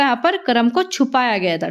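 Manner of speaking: normal talking voice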